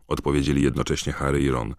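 ordinary talk

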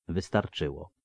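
usual speaking voice

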